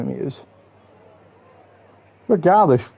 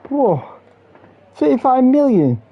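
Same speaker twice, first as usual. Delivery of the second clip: amazed whoa; 12 semitones higher, slower than usual. Real, not acted. Shocked at transfer fee.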